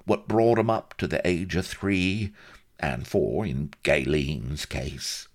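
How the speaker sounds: high-pitched, self-pitying Cockney grumble; aggrieved Cockney. A narrator's reading. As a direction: plead as the poor neglected old dad. Vladimir's self-pitying complaint.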